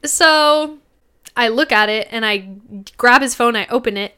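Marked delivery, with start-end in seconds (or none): none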